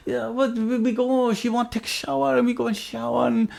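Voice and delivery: a hint of the Swedish cellmate's accent; mock Swedish accent. Cellmate tells his story in mock Swedish accent. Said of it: "Swedish accent".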